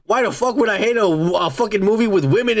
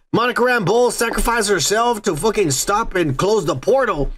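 ordinary delivery

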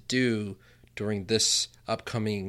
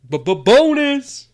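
huge stuttered hype shout, much higher; hype man shout; 14 semitones higher, 12 dB louder than usual. Shown as a demonstration. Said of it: "Say it again".

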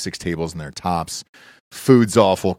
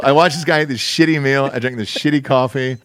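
ordinary talk, nothing marked

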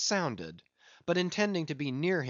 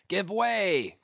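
loud, higher command; cried order; 6 semitones higher, 4 dB louder than usual. A narrator's reading. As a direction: cry it to the oarsmen. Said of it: "cried Ahab to the oarsmen".

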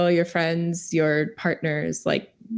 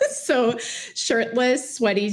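giggling through it; laughing; 6 semitones higher, much slower than usual. Real, not acted. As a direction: laugh out the words. Laughing about crush on actor.

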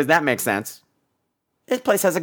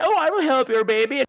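higher, lightly put-on girl voice; lightly mock an eager helper; imitating a movie heroine, pitched up; mimicked naive girl; 7 semitones higher than usual.

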